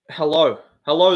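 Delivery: normal talking voice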